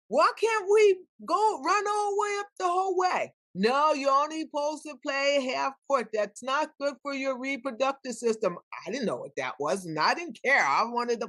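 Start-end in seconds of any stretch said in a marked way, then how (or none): 0.1-3.3 mock-pleading wail, much higher than usual; protesting whine. Retold girl protesting the half-court rule. 3.5-8.6 imitated refusal in a raised, high voice; mock scolding, pitched well up; scolding authority. Retold authority refusing the girls.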